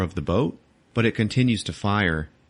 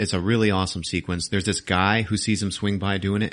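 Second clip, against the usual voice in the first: normal talking voice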